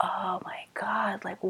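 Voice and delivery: slow, scandalized mock whisper; shocked whisper. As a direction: whisper it like an appalled onlooker. People gasping at her hair.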